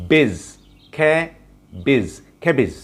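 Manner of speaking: খেবিজ slowly, with a breathy kh start; খেবিজ drawn out with a puff of air; teacher modelling খেবিজ for cabbage; aspirated kh. Shown as a demonstration. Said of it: "আমরা এটাকে বলবো খেবিজ".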